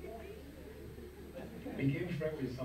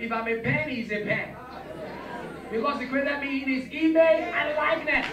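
building from firm to shouted, much higher and louder; rising declaration; 5 semitones higher, 3 dB louder than usual. Shown as a demonstration. Congregation told to tell neighbor, shouting in rising force. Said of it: "Say to your neighbor, say".

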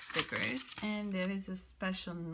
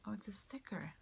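soft unvoiced aside to herself; whispered aside; 8 dB quieter, faster than usual. Real, not acted. Noting to herself it's a sticker, whispered. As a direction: whisper the realization under your breath.

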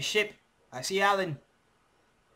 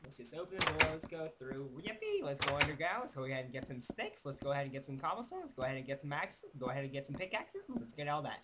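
quiet calm play narration; soft narration; 11 dB quieter, faster than usual. Acted. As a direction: narrate the steps softly.

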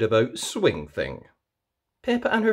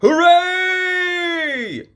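very high, loud cheer; shouting hooray; 7 dB louder than usual. A narrator's reading. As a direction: cheer together at full voice. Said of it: "everyone shouts together".

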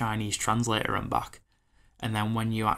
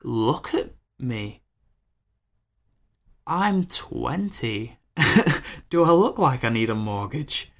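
incredulous; 2 semitones higher, 5 dB louder, slower than usual. Retold or imitated. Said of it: "And I'm like".

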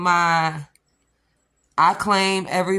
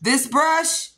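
usual speaking voice

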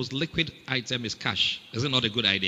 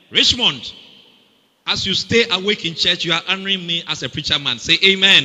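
playful call-out; 5 semitones higher, 10 dB louder than usual. Real, not acted. Teasing a congregant from pulpit.

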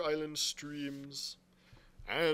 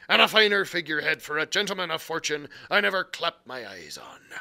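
put-on louder pirate brogue, boastful old sea cook; Long John Silver; 8 dB louder than usual. A narrator's reading. Silver boasting to the lad about Flint's crew, pirate brogue. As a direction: swagger it like a one-legged pirate cook.

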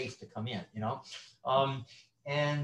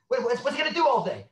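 anxious wife; 8 semitones higher, 7 dB louder, faster than usual. Retold or imitated. Wives voiced fretting about retirement.

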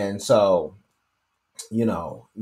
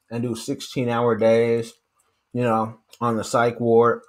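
talking while chewing. Real, not acted. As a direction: mouth partly full of jerky. Seen 2: Repeatedly brings jerky to mouth and bites while talking throughout.

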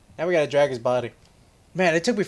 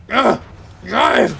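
loud, raised-pitch road rage; frustrated yelling; 5 semitones higher, 6 dB louder, slower than usual. Real, not acted. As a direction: snap loudly at a driver in the way. Yelling at traffic while driving.

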